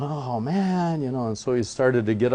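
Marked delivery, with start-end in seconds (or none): 0.0-1.0 high, put-out groan of dismay; a vain trickster let down again; dismayed Iktomi. Retold or imitated. Iktomi staring at rippled water, high put-out groan. Iktomi sees distorted reflection.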